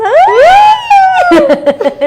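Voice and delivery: high, loud burst of laughter. Real, not acted. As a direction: shriek with laughter. Big outburst at a joke. (carcajada aguda y fuerte)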